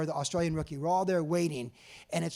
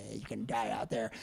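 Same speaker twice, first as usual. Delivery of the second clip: low menacing taunt; 9 semitones lower, 9 dB quieter than usual. Retold or imitated. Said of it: "Rory's just like".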